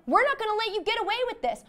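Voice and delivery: high, fast, heated defiance; defiant outburst. Retold or imitated. Players defying the crooked cop, high fast outburst. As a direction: blurt it out, fired up.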